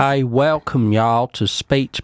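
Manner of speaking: slow, hammed-up North Carolina drawl; North Carolina accent. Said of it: "I can't do his accent".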